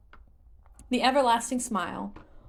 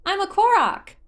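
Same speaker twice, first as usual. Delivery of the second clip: put-on high, loud, cheerful child voice; 13 semitones higher, 9 dB louder than usual. Korok introducing itself to Link, high cheerful chirp. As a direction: chirp it proudly. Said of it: "continued cheerfully".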